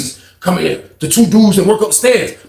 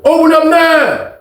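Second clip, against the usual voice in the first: drawn-out guard call; 10 semitones higher, 4 dB louder, slower than usual. Retold or imitated. Guard ordering door open.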